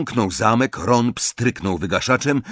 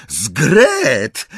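loud, drawn-out exclamation; startled outburst; 3 semitones higher, 6 dB louder than usual. A narrator's reading. Ron spots the elf and cries out, astonished. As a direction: blurt the name out in surprise. Ron blurts out, gets hushed.

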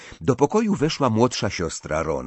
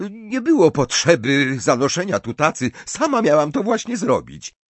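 raised, louder, put-out mother's voice; slightly huffy; 8 semitones higher, 6 dB louder than usual. A narrator's reading. Mrs Weasley objects to the tray being carried, huffy. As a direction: protest huffily in a higher pitch. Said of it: "z nieco naburmuszoną miną".

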